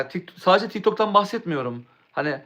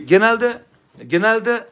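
genelde called out louder and higher; suddenly louder; 5 semitones higher, 5 dB louder, much slower than usual. Real, not acted. Streamer restarting his point to chat, loud and high. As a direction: restart the word loudly to be heard. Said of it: "eyvallah Ayaz".

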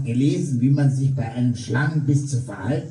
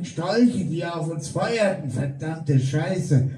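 plain reading voice

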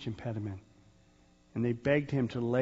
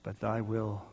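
hushed prayer; 3 semitones lower, 6 dB quieter than usual. Retold or imitated. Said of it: "who said what?".

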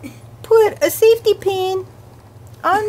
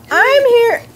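normal talking voice

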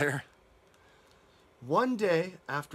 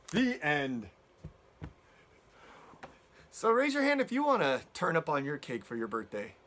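plain reading voice

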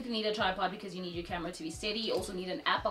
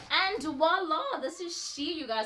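excited; 7 semitones higher, 5 dB louder, slower than usual. Real, not acted. Grand reveal of look.

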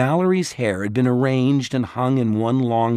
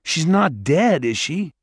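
fearful; 7 semitones higher, 4 dB louder than usual. A narrator's reading. Simon afraid his sister is dead, high and worried. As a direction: dread the answer.

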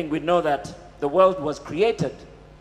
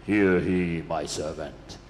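very low, slow booming voice; James Earl Jones; 12 semitones lower, slower than usual. Acted. Movie God commanding his servant, deep booming voice. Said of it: "this voice of James Earl Jones".

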